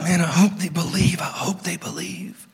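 nervous hope fading to a whisper; a worried murmur sinking into a whisper; anxious whisper. Acted. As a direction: fret under your breath. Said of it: "He's not".

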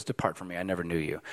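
low, hushed rabbi's dismissal; quiet rebuke. Acted. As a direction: deliver the rebuke softly, pitched well down. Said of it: "this was a rebuke".

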